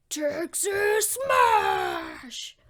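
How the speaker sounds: high, loud, hugely stretched battle cry; heroic attack shout. Acted. All Might rescues Deku, battle cry. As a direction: bellow the move name, stretching each word.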